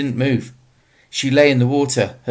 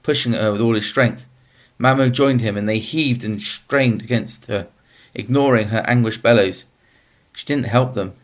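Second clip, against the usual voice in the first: usual speaking voice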